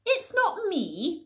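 very high, slow bleating protest; sheep's drawn-out bawling denial; bawled. Acted. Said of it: "bawled the sheep".

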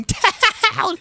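very high mock-scared cry; hammed-up wail as the chaser closes in; stretched, high yelp; panicked wail. Granny chases at full speed.

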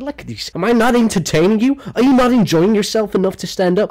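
sneering at the crowd, half-hearted. Retold or imitated.

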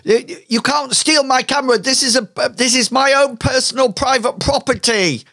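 high, fast indignant protest; guard your camera like it's your baby; squeaky outraged bystander; indignant NPC. Acted. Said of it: "he sort of, like, says".

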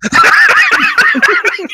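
high loud burst of laughter. Real, not acted. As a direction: cackle out loud.

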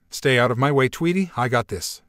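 usual speaking voice

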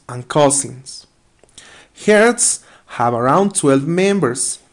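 slow, deliberate English reading in short phrases; careful read-aloud. Acted. Teacher reads elephant text to students, careful read-aloud. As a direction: read it out slowly, pausing between phrases.

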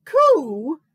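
high, loud bird coo. A narrator's reading. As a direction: coo it out long. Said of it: "with a honk, bellow, hoot, coo".

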